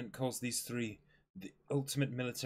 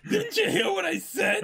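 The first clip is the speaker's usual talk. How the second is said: much higher, loud exasperated shout; shrill yell; 8 dB louder than usual. Acted. Usopp shrieks at the admiral, exasperated. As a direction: squeal it at him in frustration. Usopp repeats his demand.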